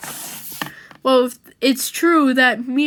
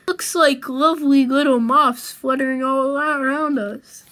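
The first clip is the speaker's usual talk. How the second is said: normal talking voice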